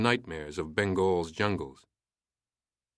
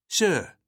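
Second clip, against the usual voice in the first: Sir said crisply and loud; a hint of an officer enunciating firmly; forceful enunciation; 8 semitones higher, 4 dB louder than usual. A narrator's reading. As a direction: bite off each sound of Sir. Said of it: "enunciated forcefully".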